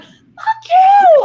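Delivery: sudden high shouted curse, half laughing; furious yell. Retold or imitated. Retelling a road-rage driver, screaming back laughing. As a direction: explode at him with a laughing scream. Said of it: "I'm cussing him back".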